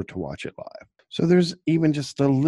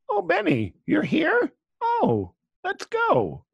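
very high mocking falsetto; slower than usual. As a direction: squeak it in a silly high falsetto. Mocking Helen's reaction.

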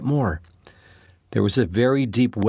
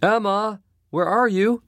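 high, carrying call from another room; mother calling for Emma from afar, raised and high; 10 semitones higher, slower than usual. A narrator's reading. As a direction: call her name from a distance, much higher. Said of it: "heard Mother calling".

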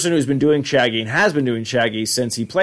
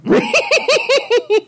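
very high put-on snickering laugh; cartoon dog snicker; 3 dB louder than usual. Scooby's cartoon laugh in the bit.

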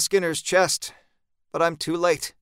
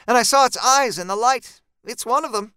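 higher-pitched, insistent character voice; raised-pitch eyewitness voice; Jean, urgent report; 8 semitones higher, 4 dB louder than usual. Acted. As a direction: report the sighting in a higher, firmer voice.

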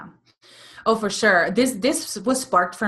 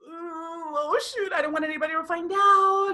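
high, hushed mock panic; mock worried gasp; 9 semitones higher than usual. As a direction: imitate someone scared their luck shows. Fearful reaction to good news.

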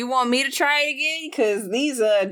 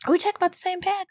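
surprised; 6 semitones higher, faster than usual. Real, not acted. Player doubts taking pad.

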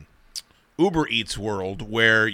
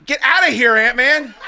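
playful shout; 11 semitones higher, 5 dB louder than usual. Real, not acted. Shooing Ant-Man out, laughing.

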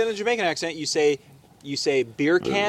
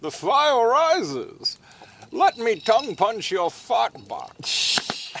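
very slow, theatrical villain voice; Bane; 4 semitones higher, much slower than usual. Acted. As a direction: draw every word out like a masked villain. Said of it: "do it in fucking Bane now".

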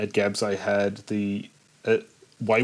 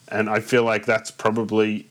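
ordinary talk, nothing marked

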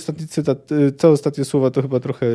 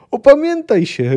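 high, loud urgent shout; forceful high-pitched yell; acted roar; 11 semitones higher, 6 dB louder than usual. A narrator's reading. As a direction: roar at a friend to bring him to his senses. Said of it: "ryknął Kahir".

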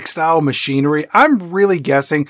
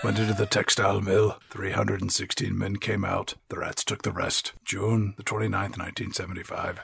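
ominous monologue voice; 6 semitones lower, 10 dB quieter than usual. Pretend. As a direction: speak like a haunted old sailor spinning a dread tale. Continues grim monologue parody.

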